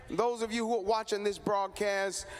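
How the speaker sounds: normal talking voice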